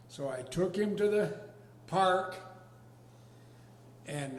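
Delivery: normal talking voice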